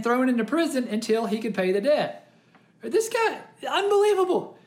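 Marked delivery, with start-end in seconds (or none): 2.8-4.6 outraged disbelief. Real, not acted. Preacher outraged at the servant.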